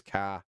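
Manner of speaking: "car" with the r dropped; Boston "car", open vowel and no final r; Boston pronunciation. Shown as a demonstration. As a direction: say "car" as "cah", Boston style. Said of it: "The only Boston thing I can think of".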